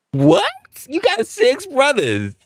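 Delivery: mock-shocked, pitch shooting up; incredulous. Man recalls his girlfriend asking him to fight, said in high mock disbelief.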